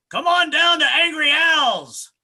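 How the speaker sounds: high, loud, slow ad-pitch yell; angry commercial. Pretend. His own angry commercial, loud slow shouted ad read. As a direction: sell it like a shouting car-lot ad. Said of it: "write a commercial for myself".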